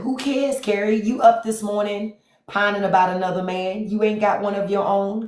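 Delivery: high, mocking call-out; teasing scorn. Real, not acted. Mocking a viewer for pining over another man. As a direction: scoff at her, pitched high.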